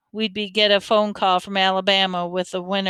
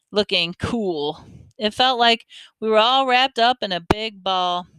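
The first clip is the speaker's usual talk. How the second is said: ordinary delivery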